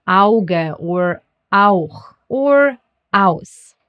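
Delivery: "Auge", "Auch", "Aus" each with an ow glide; spaced-out German "Auge", "Auch", "Aus", AU like ow. Shown as a demonstration. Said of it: "gets pronounced as au".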